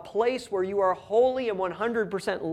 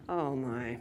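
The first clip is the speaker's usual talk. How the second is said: exasperated sigh; 8 semitones lower, 9 dB quieter than usual. Retold or imitated. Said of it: "the exasperation in Jesus' voice".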